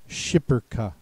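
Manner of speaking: shipperka said with a sh at the start, SHIP-per-kuh; third pronunciation. Shown as a demonstration. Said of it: "any of those three pronunciations".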